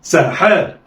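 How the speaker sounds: "ساحاء" joined in one quick go; snatch "ساحاء" with no pause; snatched together. Shown as a demonstration. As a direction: blend "ساحاء" like one word. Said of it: "يلا، اخطف".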